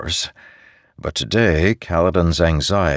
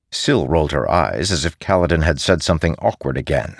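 plain reading voice

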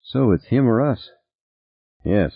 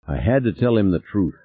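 ordinary delivery

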